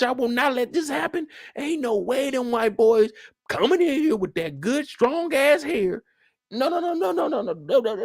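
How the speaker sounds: high, worked-up outraged voice; no no no spilling out, trailing off; outraged old man. Acted. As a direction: protest in a strained high voice. Acting out an outraged character.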